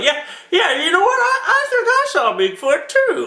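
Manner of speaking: high, far-off goofy voice, stammering; dopey copycat. Retold or imitated. High goofy voice of a fake Bigfoot witness. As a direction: play an eager attention seeker. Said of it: "people that were like".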